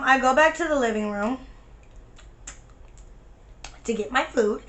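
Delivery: ordinary talk, nothing marked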